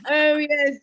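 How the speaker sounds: a prim British husband fussing; mock English accent. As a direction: lay on the posh English charm. Mocking English husband's phrasing.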